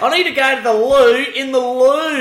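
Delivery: high, loud clueless tourist voice; mock bogan in France. Bogan asking for the toilet in France, high and loud. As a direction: blurt it like a lost tourist.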